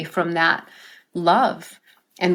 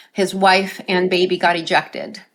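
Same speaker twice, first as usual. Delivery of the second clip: ordinary talk, nothing marked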